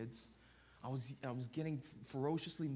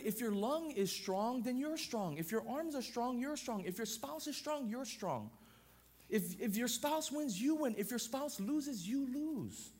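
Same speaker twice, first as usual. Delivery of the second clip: raised, rhythmic preaching cadence; pitched-up pulpit delivery; 8 semitones higher, 3 dB louder, slower than usual. Acted. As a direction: preach it, building line by line. Building up the one-flesh point.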